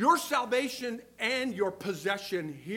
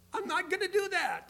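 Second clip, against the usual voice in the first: high-pitched mock protest; playful mock refusal; 10 semitones higher than usual. Older churchgoer still refusing temptation, in playful high-voiced protest. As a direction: pitch it way up, without shouting.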